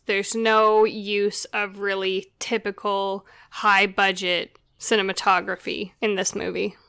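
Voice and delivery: normal talking voice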